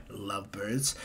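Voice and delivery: low, soft mock aside; Izuku's sly teasing. Boy leaves the pair behind, sly low tease. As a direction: drop the voice to slip in a tease.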